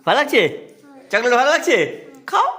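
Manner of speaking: doting dad asking if the chocolate tastes good; eager coaxing. Real, not acted. Father coaxing child with chocolate.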